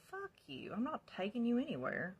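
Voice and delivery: high, loud indignant snap; retold angry refusal. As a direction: throw the refusal back, pitch leaping.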